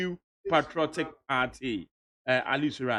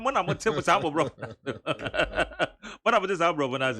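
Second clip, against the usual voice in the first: laughing; faster than usual. Real, not acted. Host laughing while asking.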